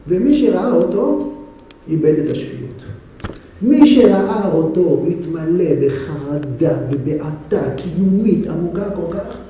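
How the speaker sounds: slow, hushed storyteller's dread. A narrator's reading. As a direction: draw it out slowly, as if afraid. Dramatic retelling of terror.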